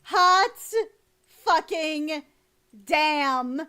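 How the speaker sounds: drawn-out loud exclamation, each word on its own; hot and bothered shout; loud swoon. Real, not acted. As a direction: belt it out, lusting after her. Reacting to a hot character.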